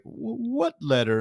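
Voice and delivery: high, slow, flustered stammer; flustered Burns. Retold or imitated. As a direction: play the old man caught off guard. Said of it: "And Burns is like".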